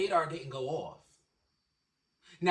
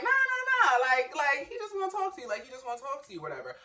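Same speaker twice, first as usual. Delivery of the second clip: imitating the guy in a much higher squeaky pitch; mocked guy; 9 semitones higher, faster than usual. Imitating the guy who says his friend wants to talk. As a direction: play the pushy guy, way up high.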